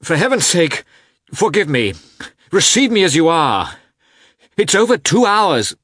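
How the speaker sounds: high, loud desperate pleading; a frantic husband begging the doctor. A narrator's reading. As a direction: beg through the door, frantic. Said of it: "in a tone of entreaty".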